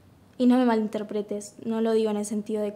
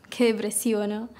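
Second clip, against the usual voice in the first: small self-mocking laugh into the words. Candidate embarrassed by her gloomy answer, laughing it off. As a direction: laugh off your own gloom.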